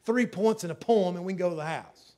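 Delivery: loud, high, urgent sermon climax; a preacher's fervent climax; preaching at full force. Real, not acted. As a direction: build and plead with the church.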